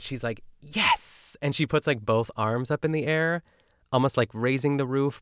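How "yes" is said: high "Yes!" shot up in triumph; mild mock cheer of Ina; excited exclamation. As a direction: cheer like a small victory. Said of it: "Ina exclaims".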